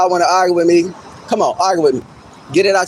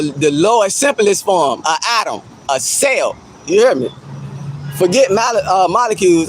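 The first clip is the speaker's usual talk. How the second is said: usual speaking voice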